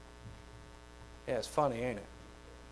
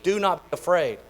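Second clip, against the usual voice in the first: usual speaking voice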